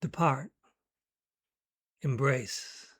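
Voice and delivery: "Depart, embrace" with weight on each second syllable; light first syllable, then de-PART, em-BRACE; iambic stress. Shown as a demonstration. Poet asking listeners to hear the sentiment, "Depart, embrace" in iambic stress. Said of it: "listen to them again".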